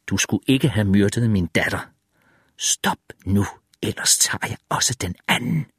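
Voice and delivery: restrained threat. Acted. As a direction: warn them under your breath. Threat to his daughter's killer.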